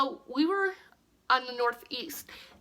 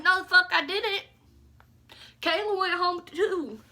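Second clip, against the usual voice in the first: loud, higher angry comeback; angry denial; 6 semitones higher, 3 dB louder than usual. Retold or imitated. Accused by the girls, loud angry denial. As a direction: push back hard and loud. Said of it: "I was like".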